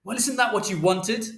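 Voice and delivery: high-pitched, accusing retort; angry shout. A narrator's reading. Father to Mother mid-row, high angry shout. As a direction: fling the question back at her. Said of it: "And Father shouted".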